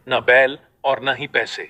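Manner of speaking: usual speaking voice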